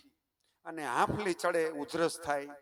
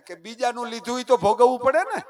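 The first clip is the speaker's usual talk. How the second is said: laughing; 8 semitones higher, 10 dB louder than usual. Real, not acted. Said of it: "સમજાય છે ને ડાયરો?".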